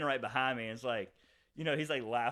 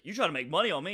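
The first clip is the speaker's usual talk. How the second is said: raised, louder mock outrage; higher, louder accusing question; indignant challenge; 5 semitones higher, 9 dB louder, faster than usual. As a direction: fire it back as an offended customer. Customer's indignant challenge, retold.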